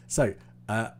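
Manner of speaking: ordinary talk, nothing marked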